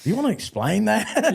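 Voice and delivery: high, deadpan challenge; imitating Boyd. Boyd staring at Bob after his joke, high mock deadpan. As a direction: ask it like an unimpressed partner. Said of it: "Boyd just be like".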